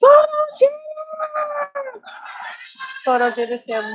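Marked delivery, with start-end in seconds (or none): 0.0-2.0 drawn-out, high excited shout; excited call. Real, not acted. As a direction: call the name out loud and long. Calls out a viewer's name.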